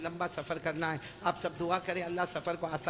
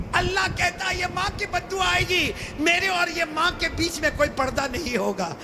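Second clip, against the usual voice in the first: high, loud warning shout; thundering preaching; 10 semitones higher, 6 dB louder than usual. Acted. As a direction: roar God's warning to the crowd. (گرجدار خطابت)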